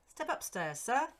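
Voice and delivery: high, loud pleading servant; entreating servant. Acted. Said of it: "entreated the departing tenant".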